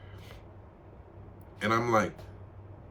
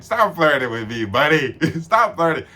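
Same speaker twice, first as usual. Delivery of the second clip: loud, amused push-back; laughing protest; 5 semitones higher, 7 dB louder than usual. Retold or imitated. Brushing off the flirting guy, loud and amused. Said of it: "I'm like".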